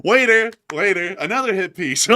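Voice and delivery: high, loud waiter call; mock calling out. Host jokingly orders from a waiter, calling out. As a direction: hail a waiter across the room.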